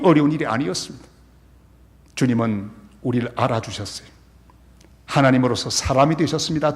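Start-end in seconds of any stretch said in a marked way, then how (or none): none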